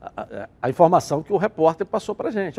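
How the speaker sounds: ordinary talk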